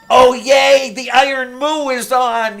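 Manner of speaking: high, loud happy squeal; excited squeal. Retold or imitated. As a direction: squeal with glee. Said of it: "and I'm all happy with".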